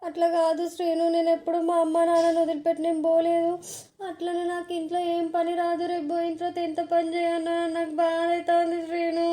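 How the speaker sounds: high-pitched, put-on tearful whine; fake bridal crying. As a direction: act the weeping bride who dreads leaving her parents. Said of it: "నువ్వు ఏడవకు". (దొంగ ఏడుపుతో పై స్వరంలో)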